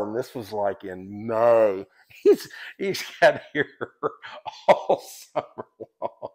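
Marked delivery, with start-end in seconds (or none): 1.9-6.3 words squeezed out through laughter; high, breaking up with real laughter; laughing hard. Real, not acted. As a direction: crack up while trying to finish. Cracks up at his own story.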